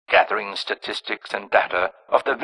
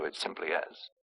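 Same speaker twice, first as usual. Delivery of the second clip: hushed whisper; 6 semitones lower, 8 dB quieter than usual. Acted. Villain names his creation.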